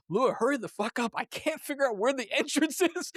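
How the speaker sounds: high-pitched mock panic; exasperated, high, comic pleading; impatient urging. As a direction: urge them on in a hammed-up fluster, laughing. Acted impatient call to partner.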